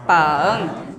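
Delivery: "bang" dipping low then rising; third tone bang. Shown as a demonstration. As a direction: say "bang" with a low dip and rise. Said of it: "the third one".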